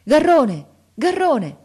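high, loud shout down the stairs; calling out. A narrator's reading. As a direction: call someone back urgently at full voice. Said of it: "lo sentimmo gridare".

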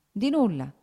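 risposta confusa, alta e pronta; confuso. A narrator's reading. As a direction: nega in fretta, colto in imbarazzo. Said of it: "rispose Konstantin confondendosi". (smentita imbarazzata)

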